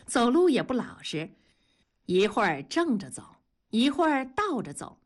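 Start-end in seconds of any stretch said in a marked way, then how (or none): none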